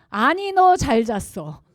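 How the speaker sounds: know-it-all husband, slow and loud; correcting husband. Retold or imitated. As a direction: overrule her firmly. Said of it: "수정".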